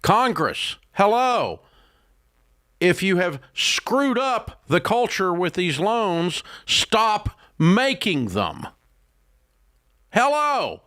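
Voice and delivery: high, drawn-out incredulous hello; exasperated hello. Real, not acted. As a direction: plead with Congress in disbelief. Exasperated call to Congress.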